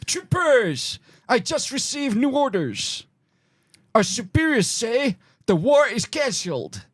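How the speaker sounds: put-on high, comic Dutch-accented officer; mock Dutch accent. To friends, playing a soldier with a hammed-up Dutch accent. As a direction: bark orders in a silly Dutch accent. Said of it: "that wasn't French".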